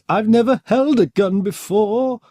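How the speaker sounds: put-on high, drawn-out frail old man voice; high quavery character quote; old professor. Acting out old professor in story.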